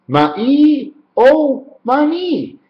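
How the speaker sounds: "Ma-i, oh, money" high, in separate pieces; sounded out slowly. Shown as a demonstration. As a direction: break it into "Ma-i", "oh", "money" with pauses. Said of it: "you can say ma".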